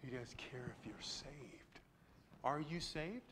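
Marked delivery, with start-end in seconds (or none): 0.0-1.8 hushed, slow whisper. Real, not acted. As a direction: drop to a whisper to stress it. Sudden hushed point in sermon.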